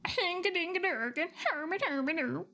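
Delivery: high, sing-song mock Swedish chef gibberish. Streamer naming her pet in a Swedish chef voice. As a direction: bounce it like the Muppets' Swedish chef. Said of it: "my Swedish chef".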